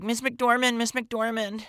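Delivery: high, eager calling after a star; lightly voiced starstruck teen calling out; teen fangirl voice. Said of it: "in my, um, sixteen-year-old".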